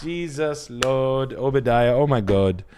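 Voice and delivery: put-on lightly done Nigerian accent, drawn out and slow; a hint of a Nigerian priest marvelling at Australians; Nigerian teacher impression. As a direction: play his old Nigerian teacher, amused and exasperated. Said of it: "Mister Obadiah was his name".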